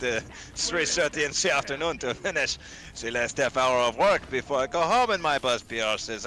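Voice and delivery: put-on broad comic French accent, breaking into giggles; cartoon French accent. Employee griping about boss Pierre, cartoon French accent. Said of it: "Wallace and Gromit level of cartoon French".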